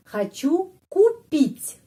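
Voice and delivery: Хочу купить with хочу as khachu, stressed on у; slow high demo. Shown as a demonstration. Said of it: "penekanannya pada huruf U". (Хочу купить pelan, tekanan pada u)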